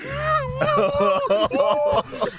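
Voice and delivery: squealing high laugh. Real, not acted. Salesman cracks up recalling the boss under his desk. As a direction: shriek with laughter.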